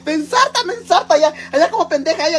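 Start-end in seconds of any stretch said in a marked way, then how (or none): 0.0-1.1 high squealing cry of alarm; mock panicked cry. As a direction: squeal it high, half laughing. (chillido agudo de susto fingido)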